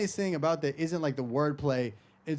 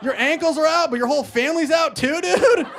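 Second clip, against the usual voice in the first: loud, high-pitched comic yelling; mock outrage; 12 semitones higher, 9 dB louder than usual. Comic scolding audience member he rooted for, mock outraged shout. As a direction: wail your letdown at the top of your range.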